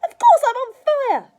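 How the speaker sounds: very high, loud indignant screech; yelled. A narrator's reading. Grandma answering George's remark, yelling. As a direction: yell it back at the top of your voice. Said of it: "she yelled".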